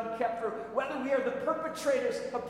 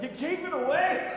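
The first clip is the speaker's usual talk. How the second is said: high, loud disbelieving question; can't believe he gave it away; mocking disbelief; incredulous coworker; 7 semitones higher, 5 dB louder than usual.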